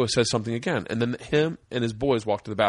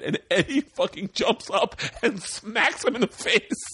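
high, slowed, laughing narration; telling through laughter; 10 semitones higher, slower than usual. Real, not acted. Recounting friend smacking the actor, high and laughing. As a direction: crack up while telling it.

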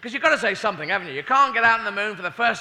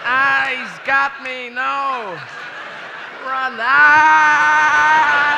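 high loud hammed-up screams; loud high mock panic; mock terrified screaming; 8 semitones higher, 11 dB louder, much slower than usual. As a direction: scream as the monster grabs you. Astronaut attacked by monster.

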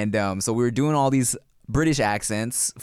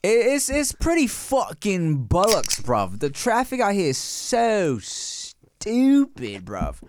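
hammed-up British street slang, words stretched out; finto accento britannico; 6 semitones higher, slower than usual. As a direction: moan in an exaggerated British accent, dropping on "bruv". Riffing in mock British slang.

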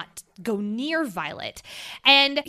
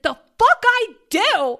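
sharp, much higher and louder snap; indignant retort; 11 semitones higher, 8 dB louder than usual. Retold or imitated. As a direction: throw it back at him, offended. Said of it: "And it's like".

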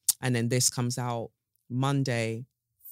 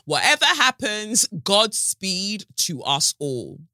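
high, loud mock-grand proclamation; theatrical send-off; 7 semitones higher, 5 dB louder, slower than usual. Podcaster launching into an unscripted solo episode, theatrical send-off. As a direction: declare it grandly, slowing right down to the end. Acts out her reckless send-off before recording.

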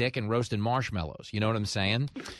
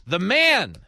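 louder, higher announcer punch on the tease; radio tease; 7 semitones higher, 4 dB louder than usual. Acted. Host teasing the next guest, in a louder announcer voice. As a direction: sell the next segment like a promo.

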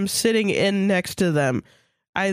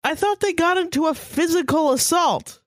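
mock confusion; 9 semitones higher than usual.

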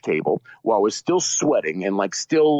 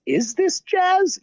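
high, slow, baffled imitation; hesitant, drawn-out questioning in a put-on voice; puzzled jazz fan; much slower than usual. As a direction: pitch way up as a mock-confused purist. Imitating doubtful jazz people.

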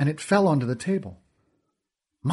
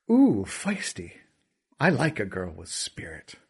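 smug villain; 5 semitones lower, 3 dB quieter than usual. Acted. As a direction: purr the lesson with a smile. Kealty leers at Essa.

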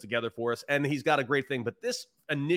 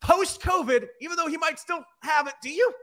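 excited; 13 semitones higher, 5 dB louder than usual. Acted. Teasing host, high excited voice.

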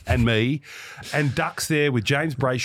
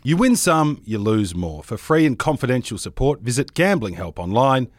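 loud, punchy sponsor read; host reading betting sponsor ad, loud; 5 dB louder than usual. Acted. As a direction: sell the bet, big and bold. Reads sponsor ad.

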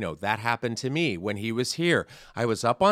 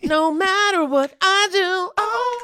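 high, slow, hammed-up wail; theatrical high-pitched cry of woe; mock dramatic lament; 7 dB louder, slower than usual. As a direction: overplay the despair.

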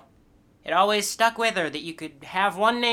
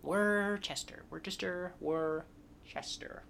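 fading repetition; 3 semitones lower, 13 dB quieter, slower than usual. Shown as a demonstration. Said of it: "it's spelled like you would pronounce the name".

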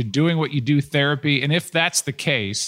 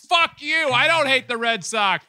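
mock Fenway fan shout; 12 semitones higher than usual. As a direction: bellow like a drunk Boston fan leaving Fenway. Red Sox fans jeering Fenway.

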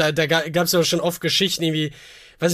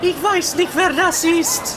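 put-on waitress voice; 10 semitones higher than usual. Mulled wine seller greets her customer in a high put-on voice. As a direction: play a sweet stall waitress, pitched high. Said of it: "Sie klingen wie Uta".

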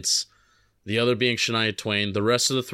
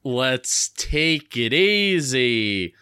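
drawn out; much slower than usual. Pretend. Calming the host down.

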